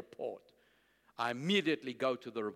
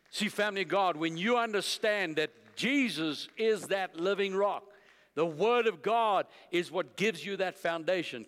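loud, raised preacher's voice; emphatic preaching; 5 semitones higher, 5 dB louder than usual. Real, not acted. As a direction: drive the point home to the congregation. Preaches to the congregation.

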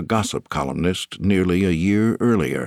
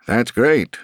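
higher, louder forced cheer; forced gladness; 5 semitones higher, 4 dB louder than usual. A narrator's reading. Stone pretending to be glad for Arrington, forced cheer. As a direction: push out a brightness you don't feel. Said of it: "making an effort to sound glad for her".

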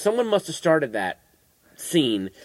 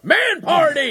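high, loud imitated theater shout; yelled out; 5 dB louder, much slower than usual. Retelling friend's outburst at the trailer, yelled out. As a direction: holler it to a whole cinema. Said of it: "Greg, just yelled out".

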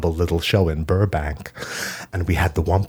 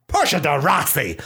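mock spat name; 8 semitones higher than usual. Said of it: "I spat back".

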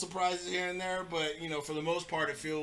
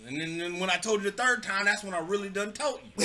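put-on dumb Southern accent. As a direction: lay on a terrible Southern accent, stretching words. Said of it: "I can do different Southern voices".

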